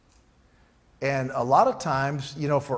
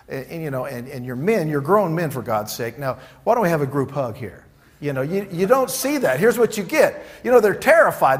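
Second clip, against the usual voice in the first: ordinary talk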